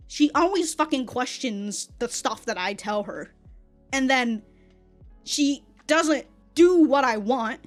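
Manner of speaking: ordinary delivery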